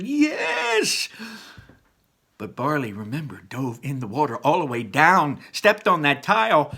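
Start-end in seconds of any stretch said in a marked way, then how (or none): 0.0-1.1 high shout of triumph; cheer. Acted. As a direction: whoop it like a win. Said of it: "Ian cheered".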